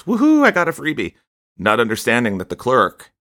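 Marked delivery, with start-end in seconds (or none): 0.0-1.1 much higher, louder mock glee; gleeful whoop. Shopper gloating to himself over unpaid item, mock glee. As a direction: crow like a customer pocketing a freebie. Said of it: "and then say to themselves".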